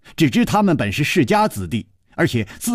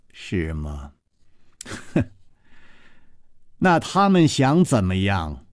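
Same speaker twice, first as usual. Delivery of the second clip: cold sneer; 5 semitones lower than usual. A narrator's reading. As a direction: look down on them with contempt. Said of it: "冷笑着说".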